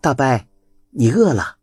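kindly voiced Chunzao offering food; kind young woman. A narrator's reading. (好心招呼老人的春早，声音偏高)

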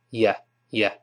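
"yeah" as a low, soft, unstressed "ya"; throwaway "ya" for "you"; weakened vowel. Shown as a demonstration. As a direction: let "yeah" drop to a reduced "ya". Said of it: "一般都变成".

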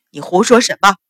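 loud, furious denial; roar. A narrator's reading. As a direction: roar it to silence her. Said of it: "阮一鸣大吼".

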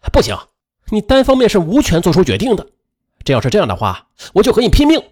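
a touch of anger, rising high on the threat; mildly angry objection. Acted. Brother defies his sister over closing the hotel, mildly angry. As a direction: put up an indignant, high-pitched objection.

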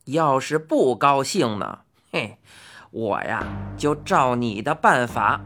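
put-on odd old man holding the upper hand; gruff bargaining old man.

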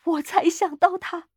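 high, agitated outburst; hysterical. A narrator's reading. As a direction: burst out with the sudden thought, high and wrought up. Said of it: "他歇斯底里地说".

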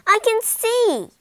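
joyful cry. Acted. Prince crying out as his sight returns, loud. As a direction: burst out with joy. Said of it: "he cried".

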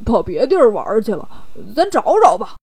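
easygoing oafish character voice; smiling Bajie. Acted. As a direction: brush off the worry in a goofy put-on voice. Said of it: "八戒笑着说".

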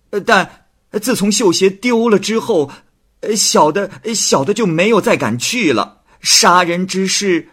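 high, stammering, pleading servant; frightened confession. Acted. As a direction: stutter and beg for mercy. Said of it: "素介只好招供说". (素介惊恐地结巴招供)

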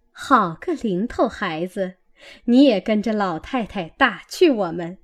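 ordinary delivery

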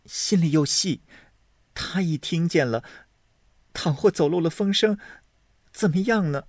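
a touch of worry in the voice; anxious maid. Acted. Hongyu frets to Zhuier about Daiyu, mildly anxious.

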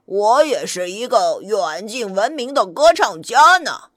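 put-on low-pitched old wolf voice; boastful old wolf, pitched well down; old wolf character. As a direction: agree proudly in a deep wolf voice. Storyteller voices the old wolf agreeing.

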